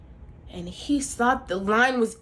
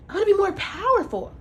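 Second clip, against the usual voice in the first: higher, quicker wife voice, wanting more; lightly voiced demanding wife; 9 semitones higher, faster than usual. Wife telling John she wants more power, higher and quick. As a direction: push for power like a greedy queen.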